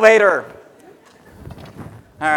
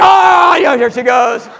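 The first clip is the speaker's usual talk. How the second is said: mock husband; 4 semitones higher, 7 dB louder than usual. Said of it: "and then you get married".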